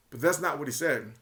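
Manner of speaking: normal talking voice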